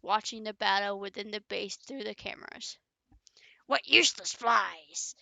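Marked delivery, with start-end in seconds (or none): 3.7-5.2 villain voice. Acted. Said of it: "said the malevolent being".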